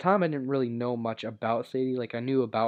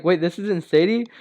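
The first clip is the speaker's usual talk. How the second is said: louder, higher disbelieving question; shocked; 6 semitones higher, 10 dB louder than usual. Retold or imitated. Echoing the sister back, loud shocked question. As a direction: blurt the realization in shock. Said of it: "And I'm like".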